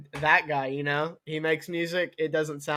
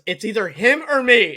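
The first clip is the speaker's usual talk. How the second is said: demanding ultimatum; 5 semitones higher, 9 dB louder than usual. Retold or imitated. Neil's ultimatum.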